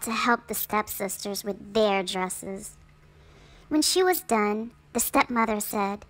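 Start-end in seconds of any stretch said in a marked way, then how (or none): none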